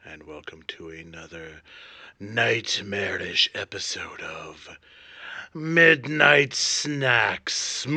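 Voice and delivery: low spooky horror host voice; jack o lantern voice. Acted. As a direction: drawl it out like a ghoulish host. Spooky cold open before host intro.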